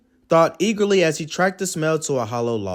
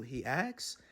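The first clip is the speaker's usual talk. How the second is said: ordinary delivery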